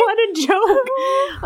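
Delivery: high squeaky laughing outburst. Real, not acted. Outraged at the book.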